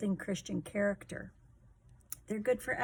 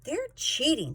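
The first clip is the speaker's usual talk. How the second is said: put-on a bit higher, indignant kid; indignant Blizzy; 7 semitones higher, 4 dB louder than usual. Blizzy telling her team at the meeting, indignant and higher. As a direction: point out the cheating, upset. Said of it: "said Blizzy".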